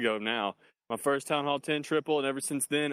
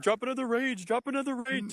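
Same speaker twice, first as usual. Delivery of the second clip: high-pitched, urgent teammate call; mock frantic urging; 10 semitones higher than usual. Teammate urging the attacker to drop a spell, mock frantic. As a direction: urge it on in mock panic.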